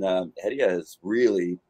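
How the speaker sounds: normal talking voice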